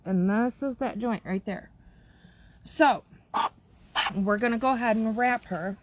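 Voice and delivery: ordinary talk, nothing marked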